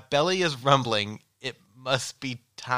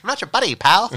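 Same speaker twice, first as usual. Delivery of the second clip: cartoon bit; 8 semitones higher, 11 dB louder than usual. Terrence and Phillip bit continues.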